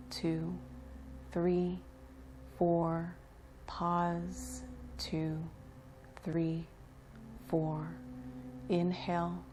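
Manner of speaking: measured, even count with spaces between words; breathing-exercise count. Acted. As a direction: pace their breath, each number settled and level.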